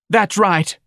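high, loud indignant wife; shout. A narrator's reading. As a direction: shout it at the husband, high and sharp. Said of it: "Missus Levy shouted".

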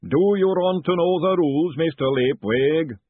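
slow, loud booming giant; booming golem voice. Acted. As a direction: boom it across the yard. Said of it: "the voice boomed out".

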